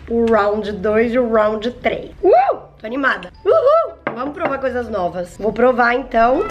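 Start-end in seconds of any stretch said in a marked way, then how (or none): 2.2-3.9 high squealing cheer; excited whoop. Real, not acted. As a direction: whoop with glee. Cheering before first bite.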